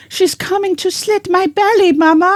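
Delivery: put-on high, loud childish wail; scared little girl voice. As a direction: squeal it like a frightened child.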